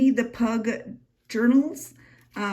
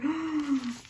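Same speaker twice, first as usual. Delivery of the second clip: delighted gasp; 8 semitones higher than usual. Real, not acted. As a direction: gasp at something pretty. Delight at dyed paper.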